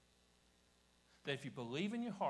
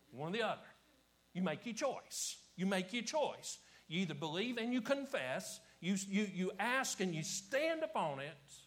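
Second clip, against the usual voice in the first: loud, raised, insistent challenge; forceful preaching; 7 semitones higher, 9 dB louder than usual. Acted. Preacher demanding listeners make their choice, forceful loud preaching. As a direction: hammer the choice home to the congregation.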